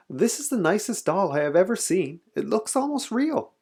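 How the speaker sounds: high-voiced, wondering admiration; admiring store lady. A narrator's reading.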